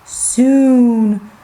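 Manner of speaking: low, slow, ominous storyteller; drawn-out, deep suspense build; story narration. A narrator's reading. Storyteller to children before the biggest goat arrives, low suspense.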